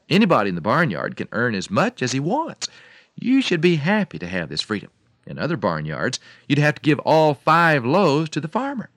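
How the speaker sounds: loud, rousing mock speech; lecturing farmer. A narrator's reading. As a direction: proclaim it to the hen like an orator.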